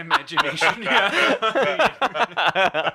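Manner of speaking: laughing through the words. Real, not acted. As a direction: chuckle it. Laughing at joke.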